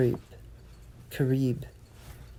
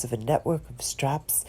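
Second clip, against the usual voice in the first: plain reading voice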